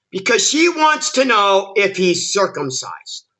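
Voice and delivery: ordinary talk, nothing marked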